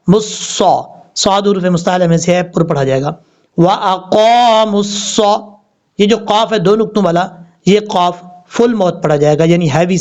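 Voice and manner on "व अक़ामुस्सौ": very slow chanted recitation; Quranic recitation. Shown as a demonstration. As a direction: intone it slowly like tajweed. Recitation demo.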